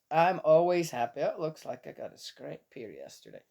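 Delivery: ordinary delivery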